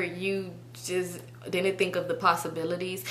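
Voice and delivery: ordinary talk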